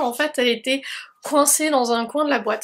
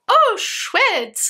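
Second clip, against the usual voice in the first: high delighted cry; light up with glee; excited; 9 semitones higher, 3 dB louder than usual. Real, not acted. Unboxing a new product.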